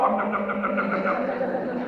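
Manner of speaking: chomp like a hungry bear eating fish; bear munching noise. Shown as a demonstration. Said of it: "and then come up and go".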